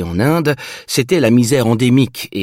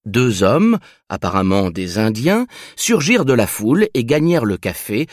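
usual speaking voice

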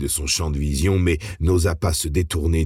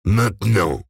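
louder, higher menacing command; menacing bark; 4 semitones higher, 3 dB louder than usual. A narrator's reading. Hybrid giving Rage a last order, menacing bark. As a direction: snap it as a final warning. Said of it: "d'un ton menaçant".

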